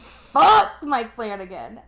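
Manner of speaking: loud, slow, half-laughing curse through real tears; drawn-out, loud tearful swear; tearful outburst. Real, not acted. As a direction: curse him out, choked up and overwhelmed. Real tears, cursing the show's director.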